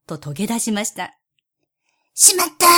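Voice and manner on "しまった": sharp cry of dismay; dismayed shout. Acted. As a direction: blurt it as the bird escapes. Cat misses its prey.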